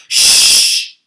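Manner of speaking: sharp loud whispered shh; whispered shush. Acted. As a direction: hush him urgently. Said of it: "the others whispered".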